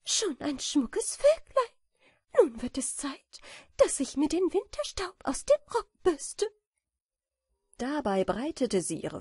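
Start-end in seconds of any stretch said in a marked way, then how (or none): 0.0-6.5 very high, delighted character voice; joyful calling. A narrator's reading. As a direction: call out with glee, pitched way up. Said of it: "rief freudig Amanda". (freudig gerufen, sehr hohe Stimme)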